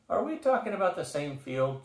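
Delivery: high, lilting innocence; innocent question. A narrator's reading. Troll needling his exasperated leader, asking innocently. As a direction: ask it lightly, pitched well up. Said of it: "Chumley asked innocently".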